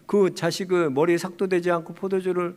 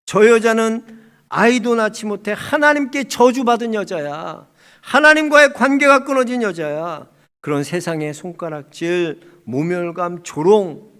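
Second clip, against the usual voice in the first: loud, pointed voicing of public scorn; scornful accusation; 4 semitones higher, 9 dB louder than usual. Onlookers pointing at a childless woman, loud scornful accusation. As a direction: hammer out the accusation, raised at the start.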